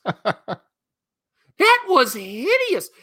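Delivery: very slow and high, dragging out hideous; disgusted drawl. Acted. Host mocks the cringe dialogue in drawn-out disgust. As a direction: stretch it out in mock disgust.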